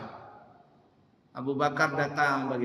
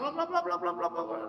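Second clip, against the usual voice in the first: high-pitched blah blah nagging; mock nagging babble; 12 semitones higher, 4 dB quieter than usual. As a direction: squeak out the wife's endless nagging. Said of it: "istri itu ngomel". (omelan istri yang ditirukan tinggi)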